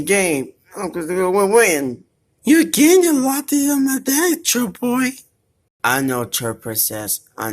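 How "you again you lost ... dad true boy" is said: squeaky high-pitched character voice. Pretend. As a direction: pipe it up like a cartoon pet.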